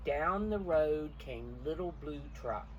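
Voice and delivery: ordinary delivery, nothing marked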